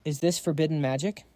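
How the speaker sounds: ordinary delivery, nothing marked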